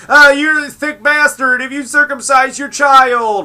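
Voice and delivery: high, loud whiny mocking voice; hammed-up mock of a preachy critic; mocking Onion. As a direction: sneer it like a preachy scold. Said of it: "Onion telling people how to raise".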